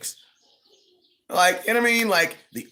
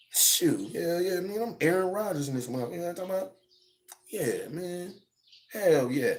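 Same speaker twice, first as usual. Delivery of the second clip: much softer, laid-back boast; mock swaggering Rodgers; 8 dB quieter than usual. Streamer imitating Rodgers boasting to his homies, softer and cocky. Rodgers bragging, quiet and far.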